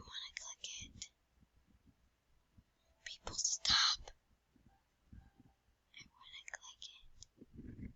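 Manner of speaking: tempted hushed whisper. Real, not acted. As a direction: whisper like sneaking.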